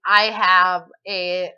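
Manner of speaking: normal talking voice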